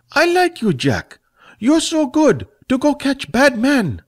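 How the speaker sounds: a tipsy little man fawning; Fat, drunkenly fond. Acted.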